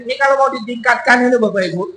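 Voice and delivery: ordinary delivery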